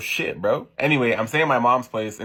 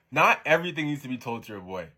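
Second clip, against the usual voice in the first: normal talking voice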